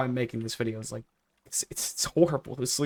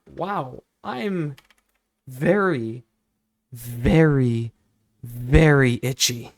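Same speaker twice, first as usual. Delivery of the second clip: very louder each time, drawn out; building exclaim; 6 dB louder, much slower than usual. Retold or imitated. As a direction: build up to a loud, slow itchy. Retold itchy complaint, building.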